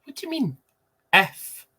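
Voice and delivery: high, incredulous What do you mean; mock outraged correction; indignant. Real, not acted.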